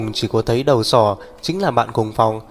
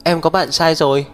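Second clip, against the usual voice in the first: mild narrated outcry, pitched higher; higher-voiced protest, lightly acted; crying out; 5 semitones higher than usual. A narrator's reading. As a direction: blurt it out, raised but not shouted. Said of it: "Uyển Tình kêu to".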